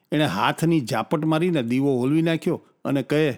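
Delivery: ordinary delivery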